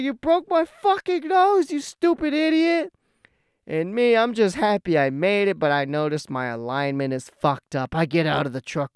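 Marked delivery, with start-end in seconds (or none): 0.0-2.9 high, loud mock outrage; angry cussing. Brother with broken nose blaming the driver, cussing furiously. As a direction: imitate an injured brother screaming abuse. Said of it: "he's just cussing at me".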